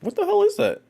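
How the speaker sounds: very high, loud squeal of disbelief; loud baffled falsetto. Real, not acted. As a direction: squeal it out, totally bewildered. Baffled by a mysterious ticking sound.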